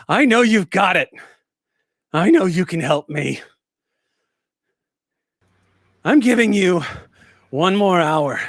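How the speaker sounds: loud threats over a PA, easing then surging; menacing woman's voice. Acted. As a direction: issue an angry ultimatum to the whole facility. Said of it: "you hear a woman's voice go".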